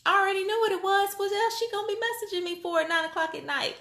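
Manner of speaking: high, quick, bubbling retelling; racing ahead because she already knows; excited disbelief. Retold or imitated. To her viewers, recalling the message, giddy and high. Reliving the moment of knowing.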